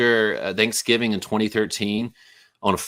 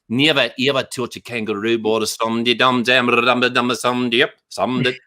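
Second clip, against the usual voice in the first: half-joking Caribbean accent that drifts into [name]-style mumbling. Shown as a demonstration. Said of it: "sounding like [name]".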